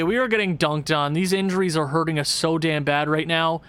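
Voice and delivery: ordinary talk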